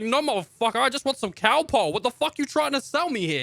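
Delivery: high, loud mock fury; mock outraged rant. Customer yelling at the podcasting pharmacist, hammed-up high fury.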